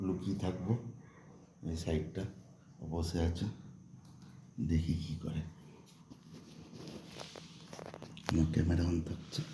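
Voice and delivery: soft, hushed aside while hiding; speaking very softly. Real, not acted. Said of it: "আমি খুব আস্তে আস্তে কথা বলছি".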